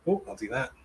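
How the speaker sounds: normal talking voice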